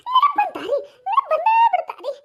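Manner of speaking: high, loud delighted laughing cry; loud laughing joy; joyful. Acted. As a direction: rejoice that she remembers. Joy at memory returning.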